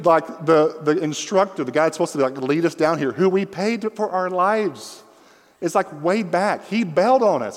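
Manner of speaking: breathless alarm climbing high; excited panic. Real, not acted. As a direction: tell it like you're back in the river, panicking. Panic retelling overboard scene.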